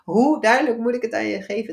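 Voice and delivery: sudden high-pitched cry of no, half laughing; a laughing inner shout of no; inner voice screaming. Retold or imitated. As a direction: let the inner voice burst out high and loud. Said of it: "alsof er iets in mij schreeuwde".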